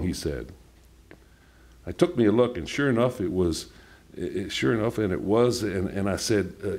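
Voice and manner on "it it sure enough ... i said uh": ordinary delivery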